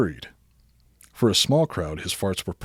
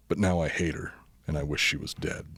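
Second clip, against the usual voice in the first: usual speaking voice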